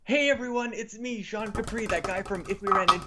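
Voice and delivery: bright, high show-opener voice; upbeat host intro. Acted. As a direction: greet the audience like a host.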